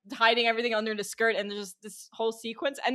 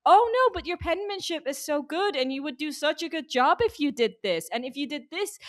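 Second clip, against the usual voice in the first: coaxing, sweet-talking higher voice; butter her up in a higher, coaxing voice; 5 semitones higher than usual. Retold or imitated. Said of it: "He was just like".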